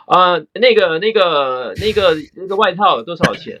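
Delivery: put-on halting Taiwanese-accented Mandarin question. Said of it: "and in a Taiwanese accent".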